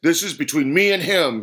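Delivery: forceful, confrontational snarl. A narrator's reading. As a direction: warn the outsider off angrily. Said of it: "he snarled".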